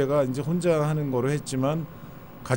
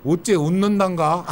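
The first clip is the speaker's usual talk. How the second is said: Honam dialect; 3 semitones higher, 4 dB louder than usual. Shown as a demonstration. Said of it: "그런 사투리도 있고".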